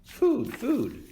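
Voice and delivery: tiny high chick peep; peeping gosling. A narrator's reading. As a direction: squeak it like a hungry baby bird. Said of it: "peeped the gosling".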